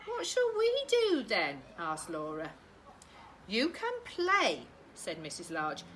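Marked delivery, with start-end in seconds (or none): 0.1-1.6 put-on high, whiny little girl; girl's voice pitched well up; high child voice. Daughter asks mum. 3.5-4.7 slow, raised, firm mum; mum's voice. Acted. Mum answering children.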